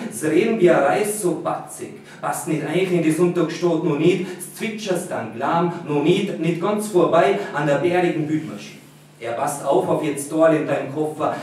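lightly voiced Tyrolean German dialect. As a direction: read it in broad Tyrolean dialect. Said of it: "which is like Tyrolean dialect".

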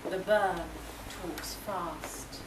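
usual speaking voice

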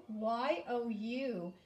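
"Y O U" letter by letter, slow; spelled out slowly. Shown as a demonstration. Teacher spells the new word slowly for the class. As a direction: spell out you slowly, one letter at a time. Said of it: "our new word is the word you".

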